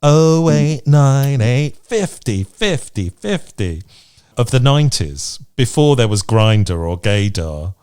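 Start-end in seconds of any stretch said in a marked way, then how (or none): none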